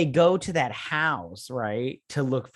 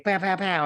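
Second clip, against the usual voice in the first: mock gunshots; 6 semitones higher, 3 dB louder than usual. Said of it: "she's like".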